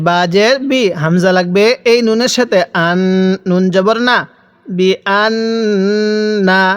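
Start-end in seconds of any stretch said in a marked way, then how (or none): 4.7-6.6 বি আন না with the doubled n sustained; ghunnah on নুন. Shown as a demonstration. Teacher shows learners the ghunnah rule, long hummed n in আন. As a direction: hold the n of আন in a long hum before না. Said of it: "মিমে নুনে তাসদিদ গুন্নাহ করা ওয়াজিব".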